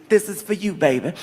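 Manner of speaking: mild seductive woman voice; sultry woman. Retold or imitated. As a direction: purr it like a seductive stranger.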